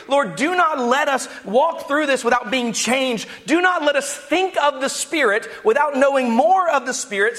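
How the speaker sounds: high, insistent pulpit delivery; impassioned preaching. Acted. Preacher urging the church to ask God persistently, impassioned. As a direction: plead with God on the congregation's behalf.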